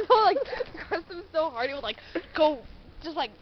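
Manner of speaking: ordinary talk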